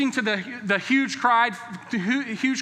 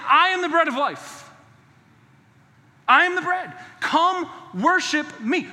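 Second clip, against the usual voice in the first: preaching Jesus; 5 semitones higher, 4 dB louder than usual. Acted. Preacher voicing Jesus's invitation.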